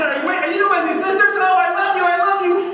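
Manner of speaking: very high, loud, gushing squeal; overexcited little girl. Retold or imitated. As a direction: gush at your new sisters like a small child. Acting girl greeting new sisters.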